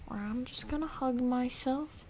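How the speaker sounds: ordinary delivery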